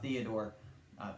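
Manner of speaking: normal talking voice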